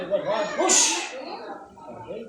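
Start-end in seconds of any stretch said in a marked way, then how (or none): 0.0-1.1 sharp, high, loud push cue; exertion cue. Real, not acted. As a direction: call out the push on the count. Therapist cueing patient to push.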